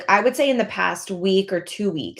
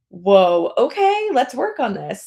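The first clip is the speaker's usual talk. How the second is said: surprised; 4 semitones higher, 5 dB louder, slower than usual. Retold or imitated. Voiced upbeat reaction to setback.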